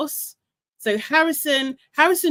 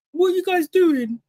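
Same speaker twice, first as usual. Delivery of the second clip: higher, challenging what are you guys doing; imitating him turning on the boys; mock confrontation; 6 semitones higher than usual. Retelling a contestant confronting smirking boys, mock. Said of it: "he turned around like".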